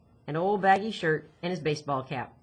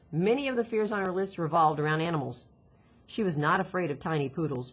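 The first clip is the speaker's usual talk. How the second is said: usual speaking voice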